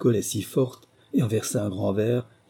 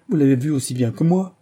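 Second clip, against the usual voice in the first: higher, louder protest; raised-voice protest; exclaiming; 5 semitones higher, 6 dB louder than usual. A narrator's reading. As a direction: cry it out at your companions. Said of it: "s'écria-t-il".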